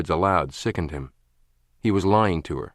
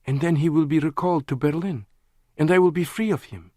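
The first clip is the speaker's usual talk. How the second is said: higher, hopeful woman's voice; 9 semitones higher than usual. A narrator's reading. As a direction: reach for freedom. Lana hopeful.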